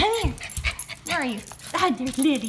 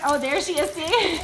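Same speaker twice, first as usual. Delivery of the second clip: normal talking voice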